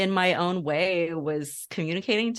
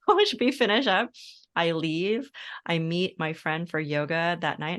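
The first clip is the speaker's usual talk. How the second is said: ordinary talk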